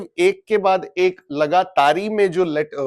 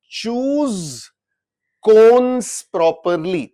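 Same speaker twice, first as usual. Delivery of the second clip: "Choose" and "cons" said slowly and apart, the CH and K sounds laid bare; slow sounding out; 3 semitones higher, 4 dB louder, much slower than usual. Shown as a demonstration. Teacher showing students the two first sounds, slowly. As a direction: drag "choose cons properly" out word by word. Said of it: "पहला sound जो है इसका क्या हो गया".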